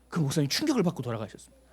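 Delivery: ordinary delivery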